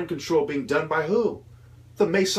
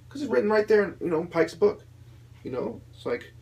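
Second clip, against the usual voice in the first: ordinary talk, nothing marked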